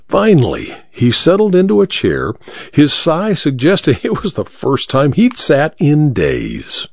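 ordinary delivery, nothing marked

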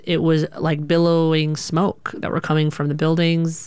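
ordinary delivery

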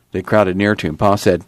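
ordinary delivery

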